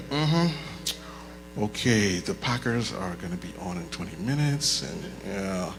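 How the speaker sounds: low, quiet mumble of someone not really listening; half-hearted agreement, thoughts elsewhere; tuned-out listener. Acted. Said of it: "you're gonna sit and be like".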